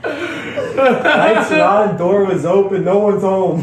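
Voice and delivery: high, loud words through real laughter; laughing. Real, not acted. Cracking up while telling his friend the house was empty, laughing. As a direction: laugh it out at the absurd scene.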